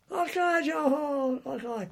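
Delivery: high, loud imitated Scottish call; broad Scottish accent. Boat seller in kilts hailing soldiers, mock broad Scottish accent. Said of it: "with a broad Scottish accent".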